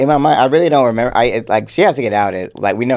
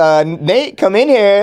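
high, put-on call to Nate; mock flirty call; 8 semitones higher, slower than usual. Host imitating Charlie calling Nate into her office, high and coy. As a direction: sing it out high like a boss summoning him. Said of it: "she'd be like".

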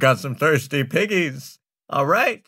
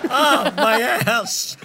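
high voice, laughing through it; laughing curse; 10 semitones higher, 3 dB louder, much slower than usual. Acted. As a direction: laugh while cursing.

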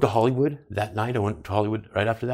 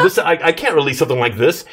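yelling; 5 semitones higher, 5 dB louder than usual. Retold or imitated. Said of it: "I'm yelling to these people".

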